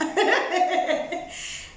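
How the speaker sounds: amused laugh. Real, not acted. Storyteller after lying down on couch, amused laugh. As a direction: laugh at your own story.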